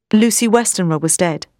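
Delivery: ordinary delivery, nothing marked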